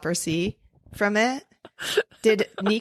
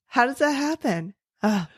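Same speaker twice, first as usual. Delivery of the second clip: ordinary talk, nothing marked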